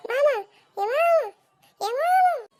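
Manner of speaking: high, loud, insistent calls; child yelling for mum. Acted. Child calls mother through the house, yelling. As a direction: yell out like a pestering kid. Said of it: "شغال تزعق ليه؟".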